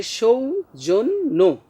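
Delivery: সৌজন্য slowly as shou, jon, no; syllable-by-syllable demo. Shown as a demonstration. Teacher sums up the rule, slow careful articulation. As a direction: break সৌজন্য into three drawn-out parts. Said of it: "মানে এসএইচ আসে".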